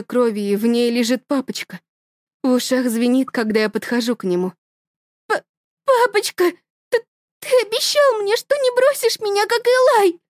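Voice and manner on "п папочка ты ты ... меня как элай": put-on much higher, small child's call; little girl calling daddy; frightened child. (высоким детским голосом)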